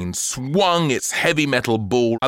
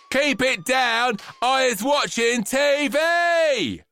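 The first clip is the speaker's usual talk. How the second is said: high, slow bratty yell; shouted; 13 semitones higher, slower than usual. Acted. Girl yelling at dad on the phone, high bratty shout. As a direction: bellow it like a spoiled child. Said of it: "shouted Sophia".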